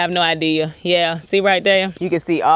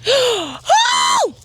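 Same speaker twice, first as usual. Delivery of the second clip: breaks into a high, excited gasp of delight; high squeal of delight. Real, not acted. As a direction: gasp at what you just dug up, thrilled. Unearthing the September garlic.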